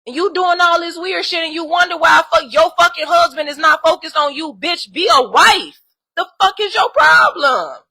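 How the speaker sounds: real outrage, screaming at the wife; furious yelling. Real, not acted. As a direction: tear into her at full volume. Angry rant at the other woman.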